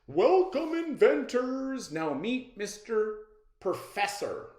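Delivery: high, loud announcer greeting; grand welcome. Acted. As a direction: boom out a showman's welcome to the inventors. Host announces to inventors.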